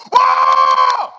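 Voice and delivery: sudden loud, high yell; huge high-pitched startling whoa; startling scream. Retold or imitated. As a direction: scream out to scare a goat. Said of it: "I go".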